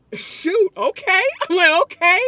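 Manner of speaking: can't believe she's paid for this; giddy disbelief. Retold or imitated. Retelling her joy at being paid to wrap silverware, giddy and laughing. Retold delight at easy pay.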